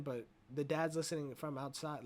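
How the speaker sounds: usual speaking voice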